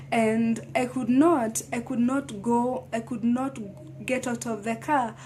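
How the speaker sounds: normal talking voice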